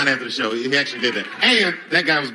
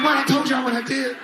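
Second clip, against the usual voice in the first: indignant surprise; 11 semitones higher than usual. Retold or imitated. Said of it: "I'm like".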